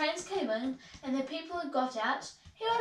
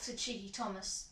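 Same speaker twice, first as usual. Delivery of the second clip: ordinary delivery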